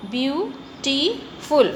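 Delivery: "beautiful" said very slowly as beau-ti-ful; syllables pulled apart. Shown as a demonstration. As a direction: stretch "beautiful" into three slow separate syllables. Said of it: "हमने तीन बार words को तोड़ के हम बोलते हैं".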